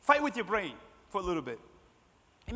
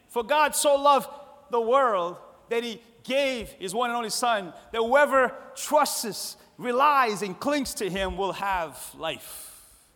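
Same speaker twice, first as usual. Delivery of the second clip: high, loud verse recitation; full-force preaching; 4 semitones higher, 6 dB louder, slower than usual. Acted. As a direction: proclaim it slowly, punching key words.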